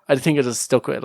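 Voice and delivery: usual speaking voice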